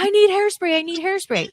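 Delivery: high, pleading, repeated insistence; urgently begging for hairspray; frantic plea. Retold or imitated. Wrestler pleading to be let go, a high frantic insistence. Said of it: "And I'm like".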